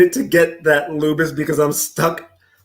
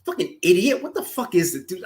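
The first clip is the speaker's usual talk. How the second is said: usual speaking voice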